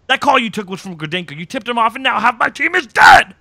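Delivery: acted anger building to a loud, high shout; pissed off. Host retells agent accusing captive over dead team, pissed off. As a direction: start heated, end yelling in outrage. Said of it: "Pissed off".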